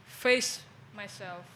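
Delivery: usual speaking voice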